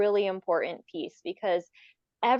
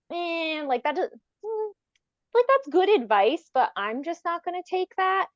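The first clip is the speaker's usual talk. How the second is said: mock inner reply; 8 semitones higher than usual. Mocking parent's inner reply.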